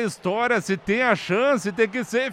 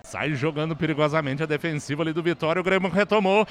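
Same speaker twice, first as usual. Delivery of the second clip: fast radio play-by-play; 4 semitones lower, faster than usual. Acted. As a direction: rattle it off live. (narração rápida de rádio)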